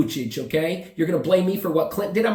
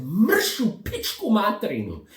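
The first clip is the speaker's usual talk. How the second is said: high, drawn-out Serbian swearing; mock Serbian cursing; 5 semitones higher, slower than usual. Traveler to bag-grabbers in Somalia, mock Serbian cursing. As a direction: curse them off slowly in Serbian. Serbian curse he should have yelled.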